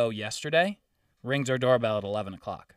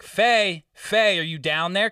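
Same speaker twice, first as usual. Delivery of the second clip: calling down the stairwell, slow and raised; 4 semitones higher, 5 dB louder, slower than usual. Retold or imitated. Man calls down the stairs to his neighbour, raised call. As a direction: shout her name down the stairs.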